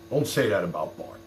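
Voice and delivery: normal talking voice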